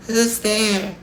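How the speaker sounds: put-on very high old-lady call; high-pitched granny query; Grandma crying out. A narrator's reading. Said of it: "cried Grandma from her bed".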